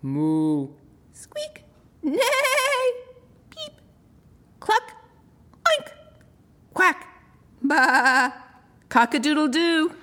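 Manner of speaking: high animal calls in turn; animal chorus. A narrator's reading. Narrator voicing the animals after tea. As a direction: voice each animal contentedly.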